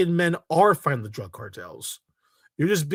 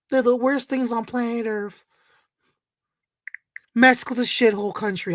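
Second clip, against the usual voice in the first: mock quote; 7 semitones higher than usual. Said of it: "I'm like".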